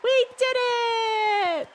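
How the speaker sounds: slow, high, stretched-out victory shout; joyful cheer. Acted. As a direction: cry out in triumph, drawing every word long. Celebrating the not guilty verdict.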